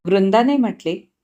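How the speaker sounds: ordinary delivery, nothing marked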